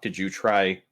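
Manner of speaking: ordinary talk, nothing marked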